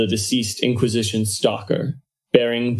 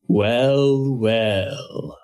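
cold, oily villain's voice, drawn out; oily blackmailer purr. Acted. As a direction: savour each word like a slick villain. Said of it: "a cold, oily voice hissed".

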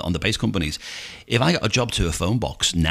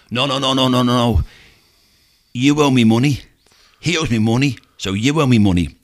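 loud, raised, insistent thug; acted menacing demand; 5 semitones higher, 6 dB louder than usual. Huge man demands money from cab driver, loud mock menace. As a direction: cut him off loudly and insist.

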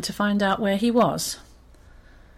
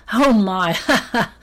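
gleeful hyena cracking up; laughing loudly; 5 dB louder than usual. Acted. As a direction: exclaim and dissolve into loud laughter. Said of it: "laughing louder than ever".

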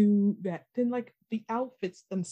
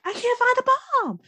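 high, loud panicked cry; 7 dB louder than usual. Retold or imitated. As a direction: shout it in a panic. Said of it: "Nora's like".

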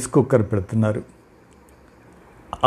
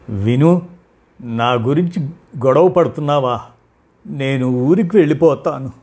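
plain reading voice

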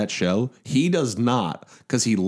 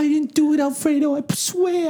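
pleading. Pretend. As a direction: swear your innocence in terror. Begging the mobster.

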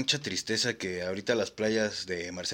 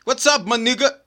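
mocking hotel clerk; 10 semitones higher, 6 dB louder than usual. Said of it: "Sí le decía".

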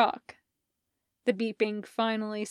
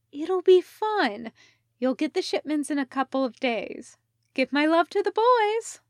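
bright Sophie; 6 semitones higher, 3 dB louder than usual. Acted. Sophie chirps goodbye on video.

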